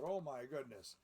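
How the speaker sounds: ordinary delivery, nothing marked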